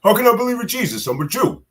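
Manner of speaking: put-on elderly Brooklyn relative, indignant, Brooklyn accent. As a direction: squeal it out in a comic Brooklyn accent. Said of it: "Uncle Irwin and Pops used to say".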